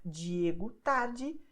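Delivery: slow Diego tarde with j sounds for D; D like jeans. Shown as a demonstration. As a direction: stretch out Diego tarde, each D as a j. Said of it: "just compare these examples here".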